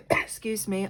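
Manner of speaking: clearing a cough mid-sentence; cough, then push the words out. Real, not acted. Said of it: "I'm still coughing".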